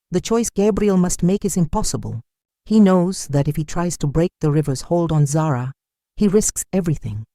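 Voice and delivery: plain reading voice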